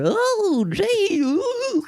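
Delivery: high, fluttery coward's gasp; cowardly whimper. Retold or imitated. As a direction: squeak like a scared coward. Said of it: "the coward in a bowler hat".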